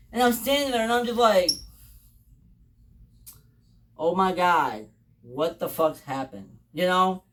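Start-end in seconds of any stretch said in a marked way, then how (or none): 4.0-6.6 low, slow, hushed shock; stunned disbelief. Retold or imitated. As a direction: let it sink out slowly, as if dumbstruck. Stunned reaction acted out.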